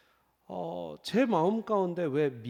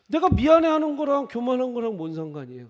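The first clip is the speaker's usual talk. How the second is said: protesting loudly; 7 semitones higher, 9 dB louder than usual. Retold or imitated. Retold protest to God.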